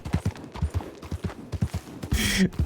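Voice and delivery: soft, high, voiceless laugh. Real, not acted. Streamer cracks up at his own invented studio name, laughing. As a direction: crack up at your own bit.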